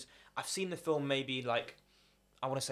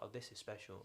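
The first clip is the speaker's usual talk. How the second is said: softer, breathless amazement; hushed awe; 4 semitones lower, 15 dB quieter than usual. Retold or imitated. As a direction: marvel at it quietly, half to yourself. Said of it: "I was like".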